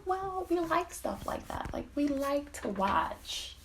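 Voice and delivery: soft, high-pitched imitation of her; coy explanation. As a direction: explain it delicately in a high, small voice. Imitates the woman explaining coyly.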